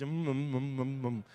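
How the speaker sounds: muffled hum through a mask; mumbling behind a face mask. Retold or imitated. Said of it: "All you hear is".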